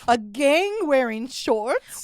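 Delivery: incredulous. Real, not acted. Incredulous repeat.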